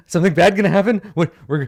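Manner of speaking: high, loud mock alarm; nervous monkeys. Game master voices monkeys about to run, lightly played panic. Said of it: "nervous monkey sounds".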